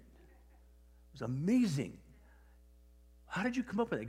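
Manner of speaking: slow emphatic praise, then high-pitched question; amazed retold reaction. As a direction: imitate someone thoroughly impressed. Retold actor amazed by the remark.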